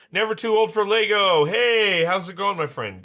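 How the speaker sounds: high, loud, exuberant shout-out; excited greeting. Real, not acted. Streamer hails a friend joining the Lego stream, excited. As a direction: burst out loud and high to welcome someone.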